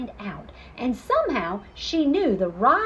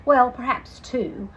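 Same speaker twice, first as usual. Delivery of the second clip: usual speaking voice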